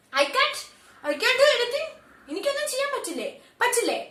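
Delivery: high loud outraged repeat of I can't; furious disbelief. Acted. Nomita outraged at being told she is powerless, furious. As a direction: fling it back in fury.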